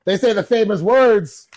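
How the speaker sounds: high and loud, slowing into the build-up; hyping up the famous line; hyped up. Retold or imitated. Hyped retelling of the wives.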